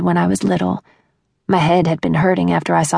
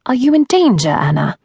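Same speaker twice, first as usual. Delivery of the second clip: put-on English accent; 6 semitones higher, 3 dB louder than usual. A narrator's reading. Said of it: "sleepy English voice".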